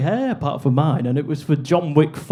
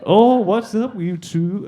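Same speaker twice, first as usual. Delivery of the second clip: mock Yankee girl, pitched up at the start; American girl; 4 semitones higher, slower than usual. Yankee girl greeting two lost tourists in an American accent. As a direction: put on a American girl's accent. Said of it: "these two Yankee girls were like".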